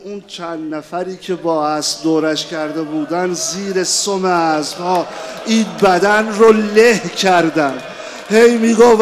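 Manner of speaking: loud, high lament for a martyr; full-voiced mourning chant, loud and high; preaching at full force. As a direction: cry the tragedy out to the mourners.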